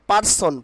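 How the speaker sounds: "person" said par-son with a sounded r; the wrong "person", plain p and r in "par"; common mispronunciation. Shown as a demonstration.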